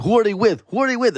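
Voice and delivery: eager asking. Retold or imitated. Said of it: "And I was like".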